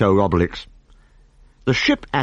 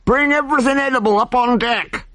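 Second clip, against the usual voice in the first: high frantic barked orders. Acted. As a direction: shout orders in a panic. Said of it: "shouted the captain frantically".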